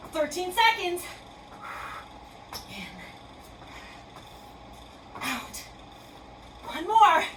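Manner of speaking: breathing cues between gasps; winded, counting breaths. Real, not acted. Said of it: "gasping for air like I am".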